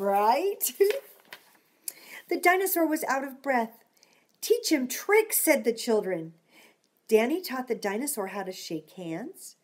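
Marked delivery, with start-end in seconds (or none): 4.4-5.4 put-on excited kids. Children's excited shouted request.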